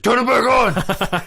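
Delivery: high, loud Sloth-like holler; Brett the janitor. Retold or imitated. Janitor left in a dark bathroom stall, mock-outraged yell. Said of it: "I flicked the lights on and off".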